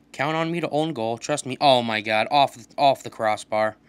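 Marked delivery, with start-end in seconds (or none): none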